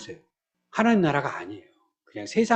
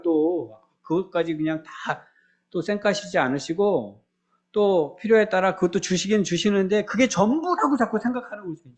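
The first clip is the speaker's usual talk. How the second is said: ordinary delivery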